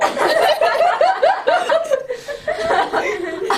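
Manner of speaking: long high giggling laugh; child's giggle. Acted. Boy laughs at his own story, giggling. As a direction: giggle away.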